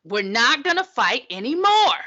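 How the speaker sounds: rally the troops, over the top; mock rousing speech. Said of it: "he's like".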